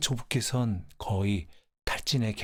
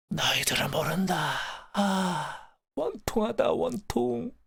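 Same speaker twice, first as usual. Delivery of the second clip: anguished cry climbing very high; dying lament; 9 semitones higher, slower than usual. Acted. Grandfather's last words to his family, high anguished lament. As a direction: wail out a last bitter grief. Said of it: "이것이 조부의 최후였습니다".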